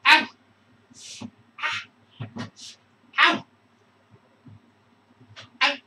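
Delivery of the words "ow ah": ordinary talk, nothing marked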